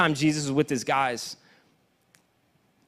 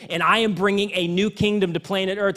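normal talking voice

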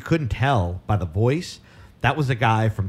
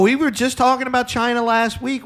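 excited outburst; 11 semitones higher, 5 dB louder than usual. Real, not acted. Heated argument about moon race.

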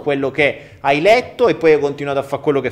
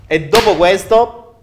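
loud exasperated goodbye; 4 semitones higher, 8 dB louder than usual. Real, not acted.

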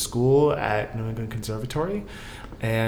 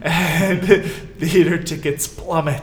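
laughing while saying it, higher and louder; 6 semitones higher, 7 dB louder than usual. Real, not acted. Guest jokes that winter ticket sales crash, laughing. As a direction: let the laugh spill into the words. Joking about the dead winter season.

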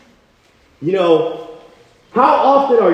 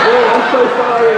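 higher-pitched mock scared apology; quivering apology; 7 semitones higher, 3 dB louder than usual. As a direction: imitate a cowed guy saying sorry. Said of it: "he's like quivering".

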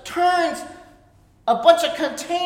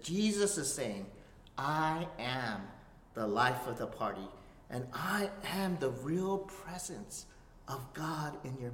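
hushed reverent preaching; 12 semitones lower, 13 dB quieter than usual. Acted. Preacher awing the congregation, soft and reverent. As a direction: drop to a near-hush to let it land.

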